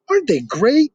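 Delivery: thrilled with the goggles; excited. Acted. Peter asking Archie about his goggles, thrilled.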